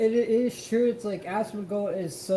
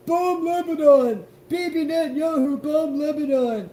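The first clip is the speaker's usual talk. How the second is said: mocking shout; 9 semitones higher, 8 dB louder than usual. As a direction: yell it like a mocking warmonger. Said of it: "Asmongold literally says".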